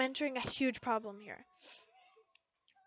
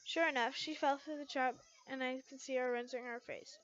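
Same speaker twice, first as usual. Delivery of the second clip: ordinary delivery, nothing marked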